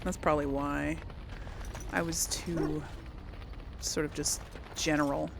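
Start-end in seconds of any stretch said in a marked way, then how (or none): none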